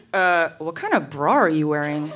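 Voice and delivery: lightly voiced boyfriend, pitched low; imitating teenage boyfriend. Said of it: "Then he goes".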